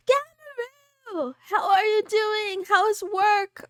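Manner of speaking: name sung out high and slow, overjoyed; thrilled greeting. Real, not acted. Delighted greeting to regular viewer.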